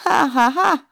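high, loud incredulity; loud mocking astonishment; astonished voice. A narrator's reading. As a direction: scoff loudly in amazement. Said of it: "d'une voix étonnée".

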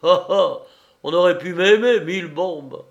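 raillerie criée, haute et forte; marin moqueur. A narrator's reading. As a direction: se moque d'elle en jurant. (marin qui raille bruyamment)

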